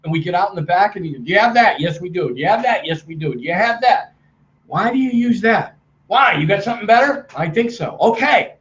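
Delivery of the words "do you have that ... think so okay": rapid high-pitched question-and-answer imitation; hammed-up high, punchy exchange; mirroring his manner. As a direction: act out both sides in brisk, matching bursts. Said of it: "I matched and mirrored how he spoke".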